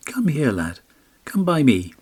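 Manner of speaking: high coaxing crone call; beckoning old woman. A narrator's reading. Old spinning woman calling the lad over, high and coaxing. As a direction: lure him closer, high and sweet.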